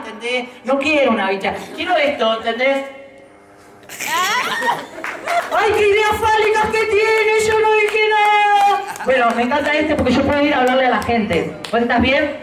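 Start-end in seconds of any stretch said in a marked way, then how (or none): 3.9-5.5 high soft burst of laughter. Real, not acted. Risa tras chiste fálico. 5.5-8.8 high, slow feigned innocence; mock innocent. Se hace el inocente tras chiste.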